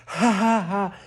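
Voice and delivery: loud, high, forced ha ha bursts; forced laughter. Shown as a demonstration. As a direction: push the laugh out hard and loud. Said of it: "letting out the laughter".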